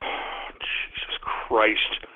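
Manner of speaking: very slow, hushed and low; exasperated sigh. Real, not acted. Host worn out after yelling, exasperated sighing. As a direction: sigh it out, nearly voiceless.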